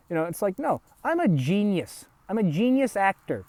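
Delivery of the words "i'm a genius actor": usual speaking voice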